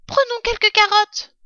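put-on very high, loud child voice; littlest fox cub. Youngest cub asking his father, high little voice. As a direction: pipe up like a small child. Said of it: "dit le plus petit des trois renardeaux".

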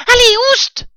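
very high, loud rat shriek; yelling rat. A narrator's reading. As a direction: shoo them off in a piercing rat voice. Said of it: "hurlait le rat". (rat qui hurle, voix suraiguë)

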